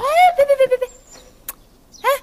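panicky, high-pitched stammered warning; alarmed protest. Acted. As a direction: frantically stop someone in their tracks. Frantically stopping someone touching things.

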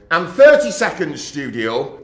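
loud call of the countdown; floor manager call. Retold or imitated. Floor manager calling the countdown to the studio, loud call. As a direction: bark the countdown across the studio.

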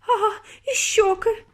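high, squealed alarm; hysterical wailing. A narrator's reading. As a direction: wail it like a horrified mother. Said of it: "лементувала пані Борогар".

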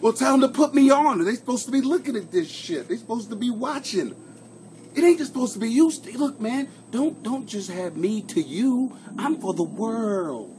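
high-pitched comic protest, drawing out the ending; put-on high voice, playing at indignation; hammed-up rant. Streamer telling a viewer to share him at work, hammed-up rant.

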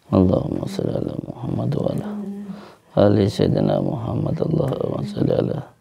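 soft, hushed salawat under the breath; murmured devotional whisper; whispered prayer. Real, not acted. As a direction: whisper the blessing as a quiet prayer. Prays salawat over the grandfather.

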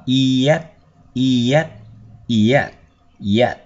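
yet built as "ee-et", joined up; gliding y onset. Shown as a demonstration. Teacher blends two sounds for students, yet as "ee-yet". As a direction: slide from a long ee into et for yet. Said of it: "Thì chúng ta nối vào".

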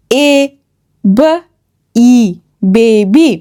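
এ, ব, ই separately, then বেবি joined; long 'ay' and 'ee', pauses between, then blend বেবি; sounding out. Shown as a demonstration. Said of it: "এ sound হয়ে যায় ই". (ধ্বনি ভেঙে ভেঙে, তারপর জুড়ে)

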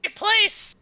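high squeaky comic voice for Rupert; Rupert's voice. Acted. Rupert tapping Zagaz, high squeaky voice. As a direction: pitch way up as the little creature. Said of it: "tap Zagaz and be like".

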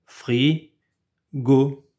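frigo split fri-go, slow and even; robot voice. Shown as a demonstration. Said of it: "Deux morceaux". (frigo haché en deux syllabes)

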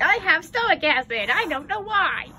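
high, loud whiny pet voice; pet's whiny voice. Retold or imitated. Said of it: "you're gonna be all like".

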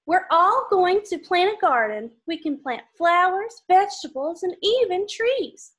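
lively storybook read-aloud; reading to children. Acted. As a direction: read it brightly for little kids. Reading picture book to kids.

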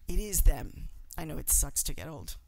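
ordinary talk

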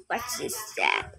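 breathy whispered question. Real, not acted. Player whispering to herself at the screen. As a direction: hush it like a secret.

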